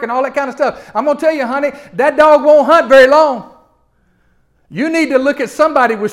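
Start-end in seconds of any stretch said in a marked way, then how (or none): none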